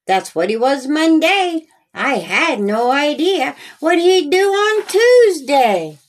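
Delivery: ordinary delivery, nothing marked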